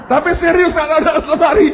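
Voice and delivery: high, loud words through laughter; laughing insistence. Real, not acted. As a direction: insist you mean it while laughing. Laughing at his own story. (bersikeras sambil tertawa, suara tinggi lantang)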